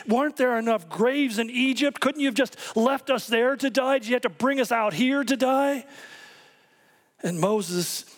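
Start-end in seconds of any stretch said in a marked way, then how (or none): 0.1-5.9 high, frantic complaining cry; panicking crowd. Retold or imitated. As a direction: wail it like a terrified crowd fleeing chariots. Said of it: "the people are panicking and saying".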